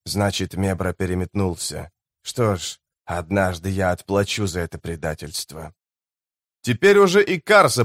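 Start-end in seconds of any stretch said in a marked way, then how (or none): 0.0-5.7 low, soft grim voice of a hardened guide; a quiet vow of revenge, voiced low; low, soft, bitter scout; grim lowlander.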